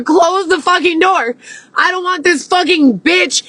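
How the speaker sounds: reliving yelling at her husband; frantic high-pitched demand; retold angry yell.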